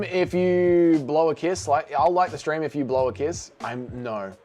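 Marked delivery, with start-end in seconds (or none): none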